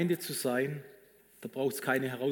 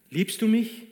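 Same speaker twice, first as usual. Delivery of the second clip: "mich" pushed higher and louder, pleading; urgent question; 7 semitones higher, 5 dB louder, slower than usual. Acted. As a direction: ask "liebst du mich?" hard, landing on "mich". Jesus asks Peter insistently.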